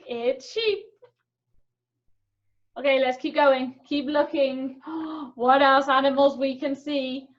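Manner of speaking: normal talking voice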